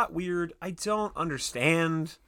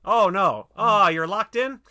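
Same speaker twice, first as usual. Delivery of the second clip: Clippy signing off; 7 semitones higher, 8 dB louder than usual. Pretend.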